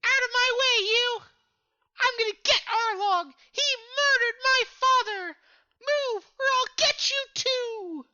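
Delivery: put-on very high, squeaky child in distress; distressed kid. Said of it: "this kid is in, like, distress".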